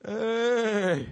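high, drawn-out fake call; robber posing as shepherd. Robber calling another's sheep, put-on high call. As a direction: sing out a phony greeting to lure them. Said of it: "robber that comes in and says".

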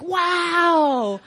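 high, drawn-out wow of wonder; mock awe. Husband stepping into the fancy apartment, awed. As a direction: imitate an awestruck husband.

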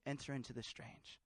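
low, hushed voice of God; a soft, deep divine command; God's quiet voice. Retold or imitated. God answering the pastor's prayer, low and hushed. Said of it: "God's like".

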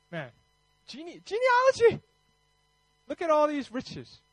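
high-pitched burst of enthusiasm; excited marvelling. Real, not acted. Preacher marvels at riches in the genealogy, excited. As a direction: exclaim it high, in delighted wonder.

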